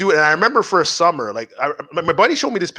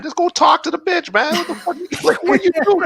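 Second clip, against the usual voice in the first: higher, fired-up push; exasperated urging; 12 semitones higher than usual. Retold or imitated. Mocking a friend who preps, high exasperated urging. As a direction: hype up a hesitant friend. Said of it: "It's like".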